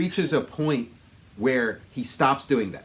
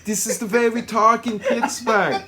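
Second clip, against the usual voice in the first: higher, louder mock Austrian accent; Schwarzenegger; 7 semitones higher, 6 dB louder than usual. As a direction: play it big with a comic Austrian accent. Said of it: "he talks the way he does".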